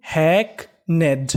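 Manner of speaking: Hackneyed slowly split into "hack" and "ned"; syllable by syllable. Shown as a demonstration. Teacher assembling hackneyed for students, slowly in two parts. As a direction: leave a gap inside hackneyed, each half drawn out. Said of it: "तो meaning बनी".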